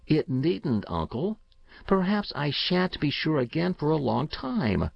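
put-on a keen boy talking up his system; eager young boy. Boy Paul voiced by narrator.

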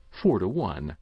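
ordinary delivery

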